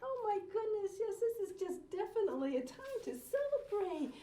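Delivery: thrilled to celebrate; excited. Acted. Grandma host gushing to children about celebrating, high and excited.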